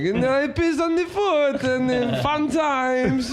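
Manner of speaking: high, drawn-out comic foreigner impression; Borat. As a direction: say it slowly as a high-pitched Borat joke. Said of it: "like a Borat joke".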